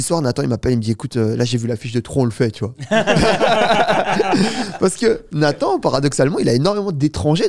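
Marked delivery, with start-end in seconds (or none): 2.8-4.8 loud, high burst of real laughter. Real, not acted. Rit de sa propre anecdote. 4.8-7.5 laughing. Real, not acted. Rit encore en reprenant.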